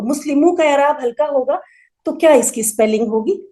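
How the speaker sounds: ordinary delivery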